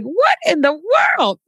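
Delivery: exaggerated incredulous question; mock astonishment. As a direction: react as a stunned listener would. Voices the listeners' astonished reaction.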